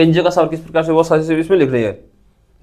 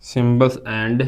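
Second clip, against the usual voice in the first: drawn out word by word for note-taking; slow, spaced dictation; 5 semitones lower, 4 dB quieter, much slower than usual. Shown as a demonstration. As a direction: dictate it very slowly so they can write. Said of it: "लिखो इसको".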